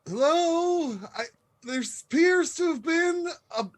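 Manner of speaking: high, careful, overly polite voice from behind a tree; nervous polite call. Acted. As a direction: call out like a timid diplomat. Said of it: "kind of go".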